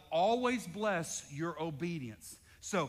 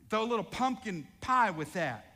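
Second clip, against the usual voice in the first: usual speaking voice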